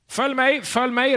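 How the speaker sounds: high, loud shepherd's call. Retold or imitated. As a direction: call the flock in. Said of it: "ropade han på arabiska". (herdens rop)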